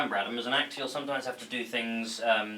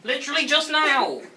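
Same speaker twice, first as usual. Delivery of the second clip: much higher, louder exasperated cry; exasperated shout; 11 dB louder than usual. Acted. Friend pressing Adam about what just happened, loud high exasperation. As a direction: throw it at him in disbelief.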